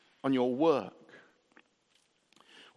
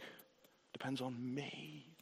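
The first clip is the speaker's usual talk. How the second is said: very soft, slow voice of the Lord; slow down and hush it to reassure; hushed, unhurried divine assurance; gentle reassurance; 16 dB quieter, slower than usual. Retold or imitated. Voicing the Lord's reassurance.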